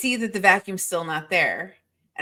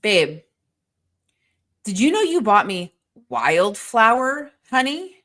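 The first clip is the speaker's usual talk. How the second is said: slow, loud, pointed question; mock accusation of the wrong honey; accusing tone; 3 semitones higher, 4 dB louder, slower than usual. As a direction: make the honey sound like a mistake. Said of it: "you said it like that".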